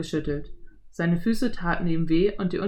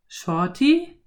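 put-on rising, baffled boy voice; boy surprised at his nickname; 6 semitones higher, 4 dB louder than usual. A narrator's reading. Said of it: "wiederholte Philipp verblüfft". (verblüffter Junge)

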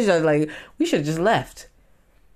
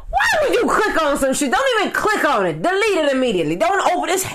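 exasperated scolding; 10 semitones higher than usual. Real, not acted. Vlogger lecturing people who click scams, exasperated shout. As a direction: scold them like fools.

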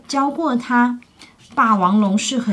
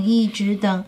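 ordinary delivery, nothing marked

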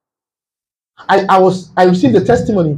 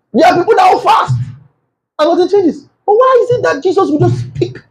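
high preaching shout; 11 semitones higher than usual. Acted.

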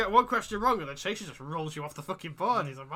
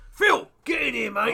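loud, raised, drawn-out tough-guy bellow; Grant Mitchell; 8 semitones higher, 10 dB louder than usual. Acted. Hard man calling Phil in, mock soap bellow. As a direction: bellow for backup, hammed up. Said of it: "He becomes Grant Mitchell".

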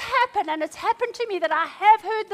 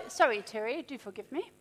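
normal talking voice

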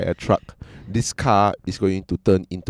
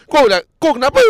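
higher, louder confrontational comeback; angry driver retort; 6 dB louder, faster than usual. Retold or imitated. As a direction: snap back at the other driver. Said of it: "the other guy goes".

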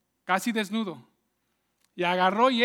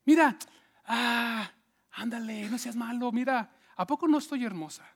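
pleading temptress. Acted. Potifar's wife presses José, mock seductive pleading. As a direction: beg him coquettishly to give in. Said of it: "le decía".

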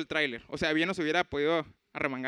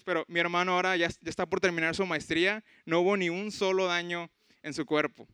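normal talking voice

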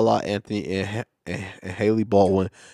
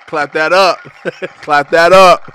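hype call; 7 semitones higher, 8 dB louder, slower than usual. Acted. Calling for applause.

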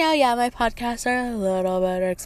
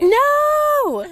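very high, loud drawn-out no; mock-appalled high shout; emphatic refusal; 5 dB louder than usual. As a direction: cry out a scandalized no, stretched long. Acted refusal of a crude request.

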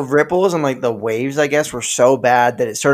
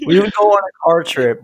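ordinary talk

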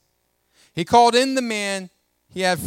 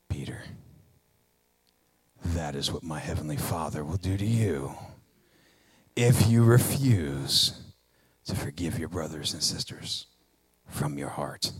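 deep solemn Jesus, softer; Jesus speaking; 6 dB quieter than usual. Acted. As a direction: deliver the verdict low and heavy. Preacher voicing Jesus' stern verdict.

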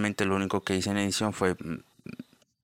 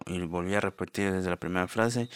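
ordinary talk, nothing marked

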